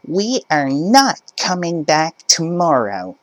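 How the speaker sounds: entitled mother. Acted. Mother insisting to cashier, high indignant voice. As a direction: push back like a pushy parent.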